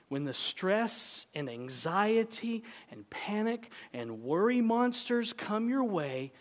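ordinary delivery

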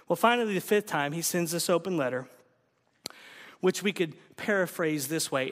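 ordinary delivery

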